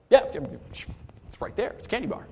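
whispered, tempted by the candy; sly kid whisper. Retold or imitated. Pastor voicing a tempted kid, whispered. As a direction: hush it like a kid eyeing candy.

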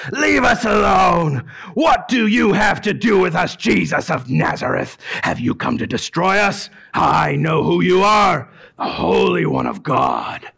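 slow, loud shouted demon lines; loud demon cry. A narrator's reading. Unclean spirit crying out to Jesus, loud. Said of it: "cried out with a loud voice".

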